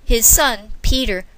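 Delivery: plain reading voice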